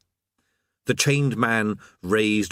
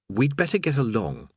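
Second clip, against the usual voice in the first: plain reading voice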